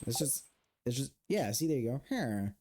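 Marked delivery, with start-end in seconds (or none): 2.1-2.5 low falling villager grunt; Minecraft villager. Shown as a demonstration. Demonstrating the villager sound to be spelled, villager grunt. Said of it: "The villager sound?".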